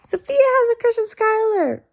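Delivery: mock schoolyard yell, pitched high; retold scream. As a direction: imitate a girl shouting it across a field. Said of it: "she screamed on the top of her freaking lungs".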